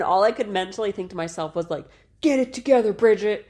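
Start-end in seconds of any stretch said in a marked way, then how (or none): 2.2-3.5 higher, louder frantic scolding of herself; angry self-talk. Retold or imitated. Mother retelling birth panic to husband, frantic self-scolding. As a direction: snap at yourself to get it together. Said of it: "I was so mad in that moment".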